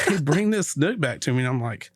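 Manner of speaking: normal talking voice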